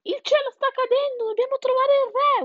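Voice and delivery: very high squealing cry; shrieked alarm. A narrator's reading. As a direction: cry out together in panic. (urlo acutissimo di allarme)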